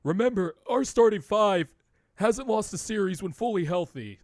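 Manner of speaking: high, loud put-on coach voice; Doc Rivers impersonation. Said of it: "do my one Doc impersonation".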